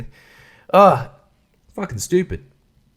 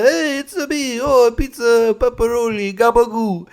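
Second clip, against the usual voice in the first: high-pitched hammed-up Italian accent; cartoon Italian accent; 8 semitones higher than usual. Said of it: "none of that accent".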